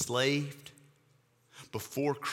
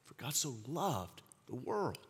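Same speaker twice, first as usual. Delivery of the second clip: soft reverent echo; 11 dB quieter than usual. Acted. Echoes verse very softly.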